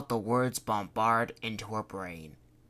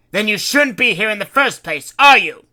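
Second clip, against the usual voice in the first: higher and much louder demand; demanding, strident; 10 semitones higher, 17 dB louder than usual. Acted. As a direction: turn on her in anger. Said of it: "Fred demanded".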